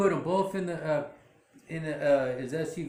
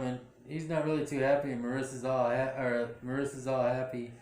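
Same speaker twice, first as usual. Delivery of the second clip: ordinary talk, nothing marked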